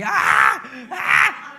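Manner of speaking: screaming. Retold or imitated. Scared husband shouting on the motorbike, panicked yelling. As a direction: yell in panic at something far off. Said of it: "yo ya estoy gritando aquí".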